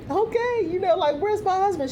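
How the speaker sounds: very high, bewildered voice; mock confused bride. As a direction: imitate her searching for the groom. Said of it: "She's like".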